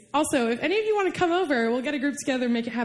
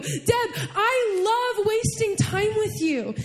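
slow, raised-pitch declarations of love; impassioned reassurance; 5 semitones higher than usual. Retold or imitated. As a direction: affirm each phrase slowly and fervently. Voices Holy Spirit's loving assurance.

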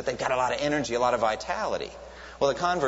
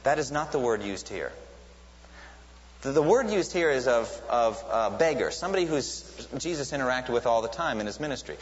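ordinary talk, nothing marked

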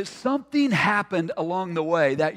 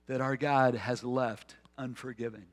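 usual speaking voice

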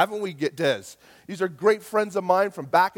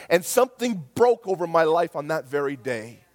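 ordinary talk, nothing marked

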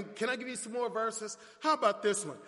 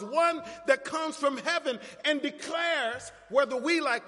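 higher, building pulpit proclamation; rousing preaching; 5 semitones higher than usual. Real, not acted. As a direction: preach it with mounting emphasis.

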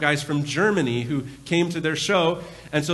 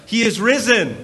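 loud, higher Easter call; He is risen called out loud and higher, falling on risen; 5 semitones higher, 6 dB louder than usual. Real, not acted. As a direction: throw it out to the crowd. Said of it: "So I'm gonna throw it out".

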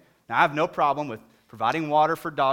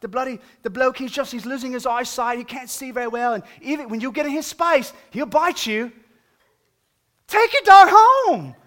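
mock lady voice; 9 semitones higher, 10 dB louder than usual. Dog owner telling him to take his dog home, high mocking voice. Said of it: "she went on to tell me".

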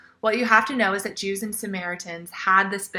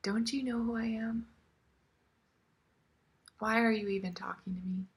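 softer, then fading to a whisper; hushed hurt whisper; 11 dB quieter, faster than usual. Retold or imitated. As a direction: voice her rejection under the breath. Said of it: "Almost like she's saying".

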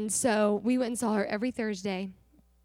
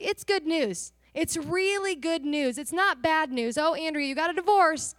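raised, louder voice quoting the Lord; emphatic proclamation; 6 semitones higher, 5 dB louder than usual. Retold or imitated. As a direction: proclaim it insistently, pitched up. Voicing the Lord insisting on good news.

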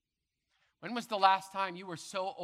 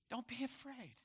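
softer still, almost hushed; softer reassurance; 8 semitones higher, 6 dB quieter than usual. Acted. Preacher repeats the comfort more softly to the listeners, hushed. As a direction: let it fall tenderly. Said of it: "this is my word to you".

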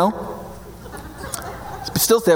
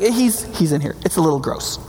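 deadpan understatement; 7 semitones lower, 4 dB quieter than usual. Acted. Jael shows the dead king.

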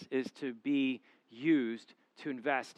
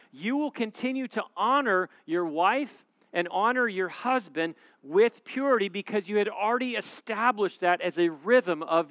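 raised, driving sermon cadence; preaching at full force; 5 semitones higher, 5 dB louder than usual. Acted. Pastor rallying single listeners to invest now. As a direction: preach it hard to the room.

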